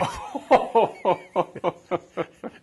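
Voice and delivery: long, high chuckling laugh; rolling run of high chuckles; real laugh. Real, not acted. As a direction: laugh helplessly at your own story. Veteran laughs at his own story.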